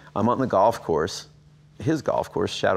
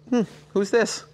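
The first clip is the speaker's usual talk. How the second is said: puzzled mock surprise. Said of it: "And I'm like".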